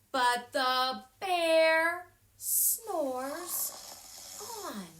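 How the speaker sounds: slow. A narrator's reading. Slow refrain about snoring bear.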